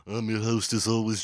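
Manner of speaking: hammed-up Liverpool host intro; host greeting in a broad Liverpool accent. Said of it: "best I can do for a Liverpool accent".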